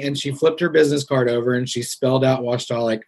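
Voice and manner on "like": ordinary talk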